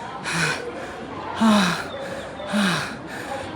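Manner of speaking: long unvoiced exhausted sigh. Real, not acted. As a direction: let out a long tired breath.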